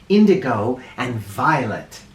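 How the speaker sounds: ordinary delivery, nothing marked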